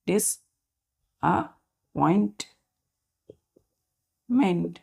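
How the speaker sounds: Disappointment broken into long, slow syllables; slow syllable demo. Shown as a demonstration. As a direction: spell it out by sound for the children. Teacher drawing out the word. (Disappointment धीरे-धीरे टुकड़ों में)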